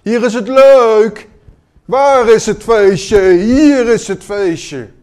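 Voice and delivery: high, slow, booming lure; hammed-up shouting invitation. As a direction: call out loudly like a party tempter. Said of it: "staat er een te schreeuwen".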